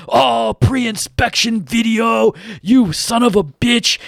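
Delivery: retelling the customer's blow-up, mock rage; mocking enraged customer. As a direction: imitate the old guy losing it. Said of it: "this dude lost his shit".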